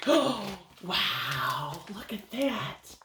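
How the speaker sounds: soft, whispered wonder; hushed wonder to the kids; hushed awe. Acted. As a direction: breathe it in amazement. Reader marvels, hushed.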